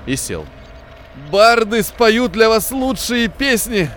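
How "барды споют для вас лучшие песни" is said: high, slow, strangled wheezing voice; strangled wheeze. Acted. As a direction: force it out through a tight throat, slowly. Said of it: "просипел он сдавленным голосом". (сдавленный сиплый голос)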